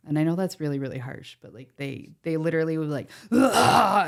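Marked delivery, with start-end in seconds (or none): 3.3-4.1 loud, high mock roar; wild animal roar. Cottagers charging at beach walkers, animal-like roar. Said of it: "they act like wild animals".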